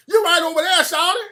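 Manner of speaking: high, loud cheerful local voice; Atlanta local. Retold or imitated. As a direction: point him over there with a grin. Local pointing the way.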